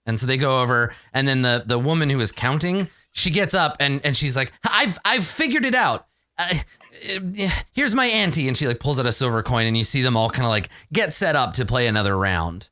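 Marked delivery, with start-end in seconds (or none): 4.7-8.5 high eager gambler voice. Acted. Counting woman joins game.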